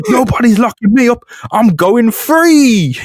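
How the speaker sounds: high, loud, slow triumphant squeal; hammed-up defiant squeal; gleeful escape. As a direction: crow it as you leap away. Spider-Man escaping, high voice.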